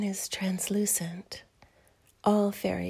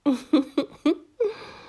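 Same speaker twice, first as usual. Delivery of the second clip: teasing giggle; 6 semitones higher, 6 dB louder than usual. Acted. Fairy slips out of gown.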